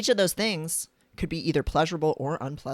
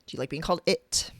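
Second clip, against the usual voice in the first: normal talking voice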